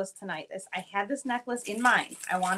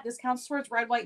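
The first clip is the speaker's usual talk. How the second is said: ordinary talk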